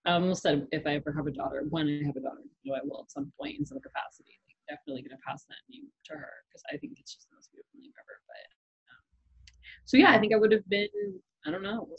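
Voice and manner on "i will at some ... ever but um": soft unvoiced whisper. Real, not acted. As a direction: whisper it like a secret. Whispering about naming a daughter.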